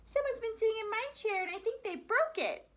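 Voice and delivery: high, squeaky little cub voice; a small bear, upset about his chair; tiny Baby Bear. A narrator's reading. Little bear tells his parents his chair is broken, high and squeaky. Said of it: "And Baby Bear said".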